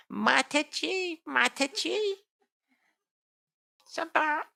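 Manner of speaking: high, thin whispered beggar's plea; old Brahmin priest. Acted. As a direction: beg like a frail old priest. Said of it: "an old Brahmin priest".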